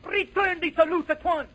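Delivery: high, loud, exclaimed order; urgent command. Acted. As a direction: order it loudly and indignantly. Governor orders aide to return salute.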